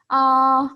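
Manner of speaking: loud, high, level "Ah"; first tone. Shown as a demonstration. Patient opening mouth for the dentist, high and level. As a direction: hold "Ah" wide and steady as for a dentist. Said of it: "he will let you open your mouth".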